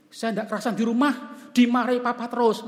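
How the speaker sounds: high, loud whiny student voice; student complaining. Retold or imitated. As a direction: play the fed-up student venting. (mahasiswa mengeluh dengan suara tinggi dan keras)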